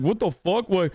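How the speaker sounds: high, whiny baffled boss voice; mock owner whining that workers won't come back; mocking business owners. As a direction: play a clueless owner who can't get it. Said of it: "They're like".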